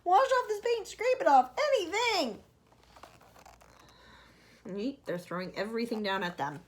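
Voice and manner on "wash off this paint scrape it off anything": high, loud, slow pleading; centipede bawling. Acted. Centipede bawling to James to wash off the paint. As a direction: beg desperately for help. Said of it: "bawled the centipede".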